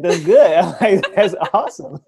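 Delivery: loud, laughing congratulations; laughing delight. Retold or imitated. Chiropractor congratulating a patient on digestive progress, laughing loudly. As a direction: burst out cheering the patient on through laughter.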